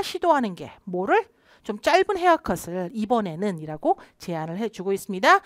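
ordinary talk, nothing marked

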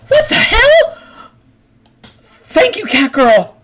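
very high put-on royal lady voice; squeaky, hammed-up falsetto Empress. Empress reacts to the cat girl who saved her, falsetto Empress voice. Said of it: "the Empress is going to you and like".

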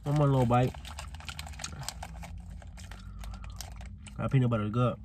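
talking between bites; chewing while talking. Real, not acted. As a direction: mouth partly full. Seen 1: Bites bar at -1.0; closed-lip jaw motion while talking after.

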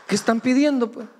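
playful high challenge; teasing question. Real, not acted. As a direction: tease the congregation about their prayers. Teasing congregation.

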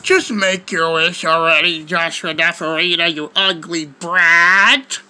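very low genie voice, last word dragged upward; mean genie. Acted. Genie demanding a wish from the kid, low impatient voice. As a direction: order it in a deep put-on genie voice. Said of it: "the genie will be like to me".

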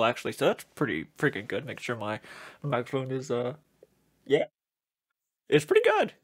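muffled behind rubber mask; speaking inside costume head; through a full face mask. Real, not acted. Seen 0: Latex alien mask covers whole head and mouth throughout labelled speech.

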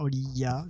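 Oliya with a Y glide before the final A; Oliya, ending in "ya", as another guess; tentative pronunciation. Shown as a demonstration. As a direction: try saying Oliya with a soft "ya" ending. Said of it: "je sais pas comment ça se prononce".

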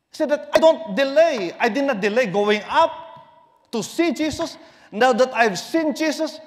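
loud, high calling sermon voice; fervent preaching. Acted. Preacher voicing Jesus calling Zacchaeus, loud and ringing. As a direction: proclaim Jesus's call to come down.

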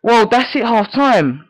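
normal talking voice

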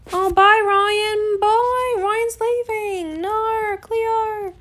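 high, drawn-out mock wail; hammed-up whine of goodbye; mock-sad farewell. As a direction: wail the goodbye in pretend heartbreak. Mock grief as friend leaves.

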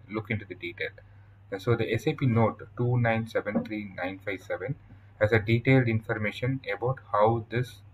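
ordinary talk, nothing marked

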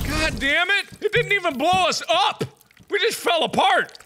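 high-pitched whining outrage; exasperated. Pretend. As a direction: complain in a high, indignant voice. Complaining after failed crash.

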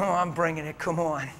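ordinary delivery

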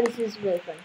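usual speaking voice

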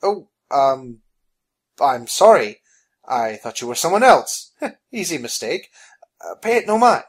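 halting, with awkward little laughs; nervous apology. Acted. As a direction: stammer an apology to placate a giant. Phineas nervously apologises to giant.

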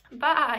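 loud, high pained baa; storyteller's hurt lamb cry; crying out bleat. Acted. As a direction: yelp like a lamb scraped by a branch. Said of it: "he cried out".

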